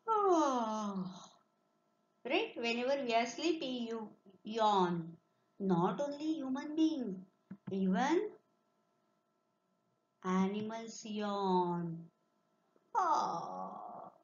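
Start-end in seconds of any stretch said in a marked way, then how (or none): 0.1-1.0 big open yawn. Shown as a demonstration. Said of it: "Make the sound of yawn". 12.9-14.1 long sleepy yawn. Shown as a demonstration. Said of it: "So this is yawn".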